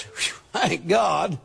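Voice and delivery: low, slow sigh of relief; a slow exhale of relief; relieved sigh. Shown as a demonstration. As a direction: breathe out relief, slowly. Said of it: "we're gonna do like this".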